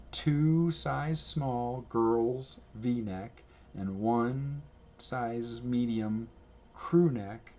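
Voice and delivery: slow, deliberate listing of sizes; reading off a slow list; slow careful order list. Retold or imitated. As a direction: spell out the order slowly. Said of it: "And I'm like".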